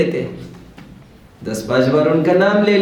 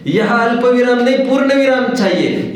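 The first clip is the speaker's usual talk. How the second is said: high-pitched, insistent mock rebuke; acted scolding; 9 semitones higher, 3 dB louder than usual. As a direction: scold a fool over misplaced punctuation. पूर्ण विराम न समझने पर डाँट. (ऊँचे सुर में बनावटी डाँट)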